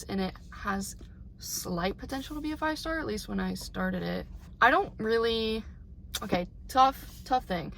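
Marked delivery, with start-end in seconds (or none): 0.0-4.3 hushed, breathy-free whisper, sharing a guilty aside. Real, not acted. Vlogger telling viewers a book's potential, whispered. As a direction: whisper it like a half-secret confession. Aside about a disappointing book.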